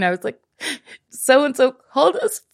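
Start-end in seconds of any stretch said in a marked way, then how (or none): 1.1-2.4 higher-pitched imitation of a tearful kid; mock-wail it like an upset child; retold crying. Kid telling mom about being cursed at, imitated crying. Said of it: "And I started crying".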